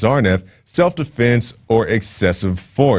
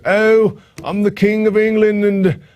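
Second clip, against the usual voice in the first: high, hammed-up fake British accent; mock a royal with a bad British accent; a British accent done badly on purpose, pitched high; horrible British accent; 12 semitones higher than usual. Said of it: "That's a horrible accent".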